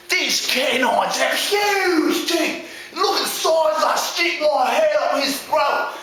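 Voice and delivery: high, drawn-out nature-host commentary; slow, excitable Australian-style piggy; alarmed Aussie first pig. Acted. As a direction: narrate the danger like an Aussie adventurer. First pig sees wolf.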